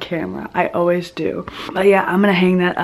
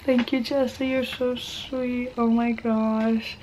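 close to tears; 3 semitones higher, 6 dB quieter, slower than usual. Real, not acted. Vlogger thanking friend for the letter, tearfully touched. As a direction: let it go small and slow, touched. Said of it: "I need a tissue".

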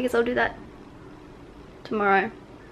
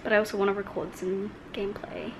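whisper; 3 semitones lower, 10 dB quieter than usual. Real, not acted. Planning the day.

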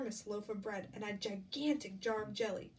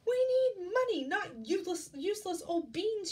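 high, loud storybook outburst; furious mother's cry; 8 semitones higher, 6 dB louder than usual. A narrator's reading. Mother scolds Jack over the beans, angry cry. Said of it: "she cried".

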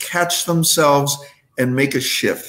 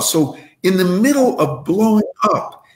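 ordinary talk

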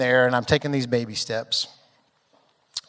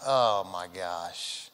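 dismayed; 9 semitones lower, 10 dB quieter, much slower than usual. Retold or imitated. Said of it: "And I'm like".

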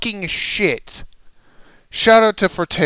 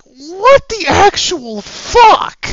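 higher and louder, slow, outraged yell; furious yell; 11 semitones higher, 8 dB louder, slower than usual. Real, not acted. Yelling at YouTubers who keep filing false strikes, slow furious yell. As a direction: drag out the outrage word by word. Ranting about a copyright strike.

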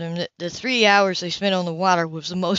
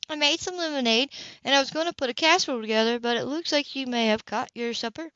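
light, higher woman's voice; young woman's voice; 5 semitones higher, 3 dB quieter than usual. Acted. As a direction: play the cheerful housekeeper.

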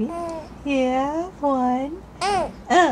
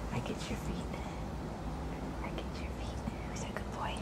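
whispering; 18 dB quieter, much faster than usual. Real, not acted. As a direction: whisper it softly and close. Whispering to the baby.

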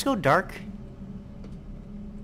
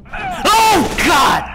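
high, loud shriek of shock; terrified shout; 12 semitones higher, 9 dB louder than usual. Real, not acted. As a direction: scream it as if ambushed. Jump scare.

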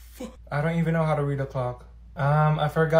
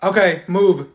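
stern teacher; 8 dB louder, slower than usual. Acted. Teacher ordering students.

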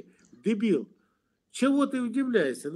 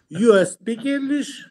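laughing, higher broken English; mock broken English; 4 semitones higher than usual. A man teasing his rival in laughing broken English. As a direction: play the clumsy foreigner, laughing.

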